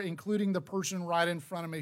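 ordinary talk, nothing marked

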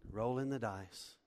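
normal talking voice

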